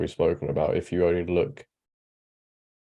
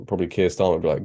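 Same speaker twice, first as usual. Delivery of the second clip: ordinary delivery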